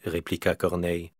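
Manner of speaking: plain reading voice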